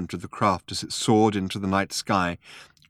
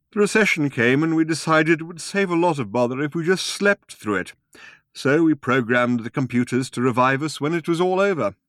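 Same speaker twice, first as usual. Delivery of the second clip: put-on old man voice; 7 semitones higher than usual.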